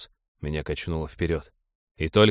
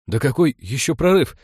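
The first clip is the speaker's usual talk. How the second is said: higher, louder, confused question; rattled, raised-voice denial; flustered protest; 6 semitones higher, 6 dB louder than usual. A narrator's reading. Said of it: "сбивчиво заговорил я".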